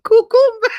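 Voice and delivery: high, loud sing-song "Cucumba"; teasing coworker in the hallway, high and loud; teasing call. Retold or imitated. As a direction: call it out to crack her up. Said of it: "I would be like".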